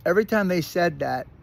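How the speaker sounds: ordinary talk, nothing marked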